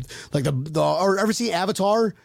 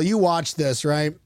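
usual speaking voice

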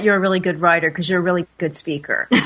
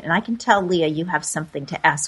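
ordinary delivery

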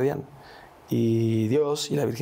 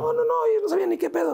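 much higher and quick, a rush of no's; excited denial; 3 dB louder, faster than usual. Real, not acted. Insisting to his friend that nobody knew, a high, fast rush of denial. Denies they had noticed.

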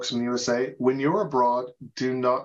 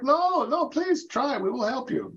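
high-pitched, pleading reassurance; imitated eager encouragement; 11 semitones higher than usual. Dutch friends to a learner of their language, eager encouragement. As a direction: voice friends urging someone to have a go.

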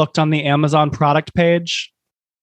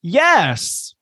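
all caps yes; 6 semitones higher than usual. Acted. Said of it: "all caps".

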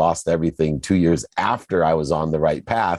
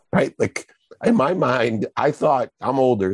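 ordinary delivery

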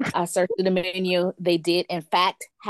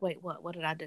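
puzzled; 3 semitones lower, 11 dB quieter, faster than usual. Retold or imitated. Her confused reply to cashier.

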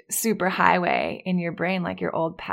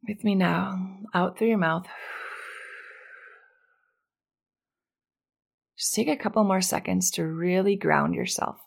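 calm grounding exercise. Acted. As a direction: ease them gently into calm.

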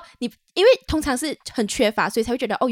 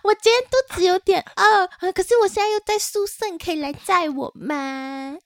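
put-on cutesy girl voice; 7 semitones higher than usual. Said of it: "他说".

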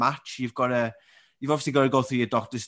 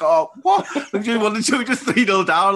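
much higher and louder, laughing in disbelief; 9 semitones higher, 8 dB louder than usual. Retold or imitated. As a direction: squeal it through laughter like it can't be true. Disbelief at mum's reply.

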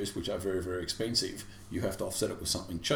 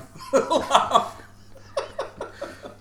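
real laugh; 6 dB louder than usual. Real, not acted. Laughing about the soundtrack.

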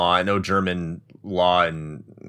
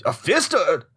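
put-on failed German accent; 7 semitones higher than usual. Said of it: "I can't do a German accent".

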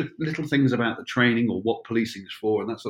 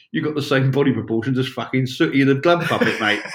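higher and louder, a blunt bloke; 3 semitones higher, 5 dB louder than usual. Retold or imitated. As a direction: throw the insult like a cheeky storeman. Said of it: "he says".

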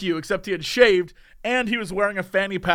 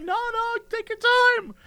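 very high, squeaky mock politeness; giddy encouragement; slower than usual. Onlooker urging the barista to continue, giddy high voice. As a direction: squeal it in delighted eagerness.

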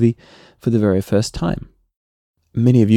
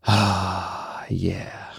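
soft breathy satisfied "ah, yeah"; contented sigh; 8 dB quieter than usual. Retold or imitated. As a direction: sink into the couch with a relieved sigh. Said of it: "sitting down on the couch and going".

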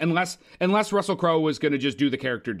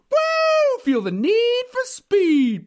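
high, loud movie-catchphrase shout; excited whoop; 5 dB louder, much slower than usual. Acted. Host hyping Top Gun Maverick, excited high shout. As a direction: whoop it out and stretch the words.

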